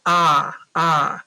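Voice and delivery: short open "ah", said twice like the o in octopus; short o sound. Shown as a demonstration. Teacher to class, modelling the short o: clear "ah". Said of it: "it's gonna say".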